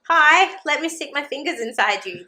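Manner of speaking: mock her bluntness with a sing-song; sarcastic mock doctor. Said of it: "So I was like".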